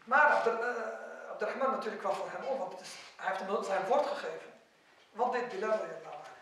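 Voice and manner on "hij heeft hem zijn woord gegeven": ordinary talk, nothing marked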